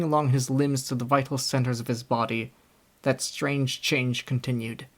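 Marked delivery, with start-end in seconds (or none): none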